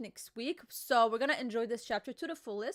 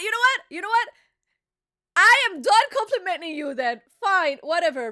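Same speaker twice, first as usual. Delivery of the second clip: loud, raised, fed-up retort; indignant outburst; 5 semitones higher, 9 dB louder than usual. Real, not acted. Host done complimenting co-host, loud and indignant. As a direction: get huffy and louder, giving up on them.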